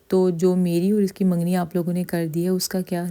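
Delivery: ordinary delivery, nothing marked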